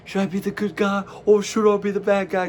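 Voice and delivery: high, loud hammy wrestler; mock wrestler voice. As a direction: ham up the wrestler's dilemma. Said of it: "Cody holds the title".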